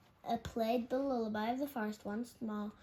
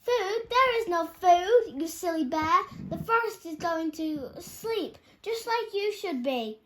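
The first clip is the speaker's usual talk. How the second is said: loud, higher angry scolding; shouting; 7 semitones higher, 9 dB louder than usual. A narrator's reading. Dormouse shouts at the hungry bear. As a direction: tell the bear off at full voice. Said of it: "Dormouse shouted".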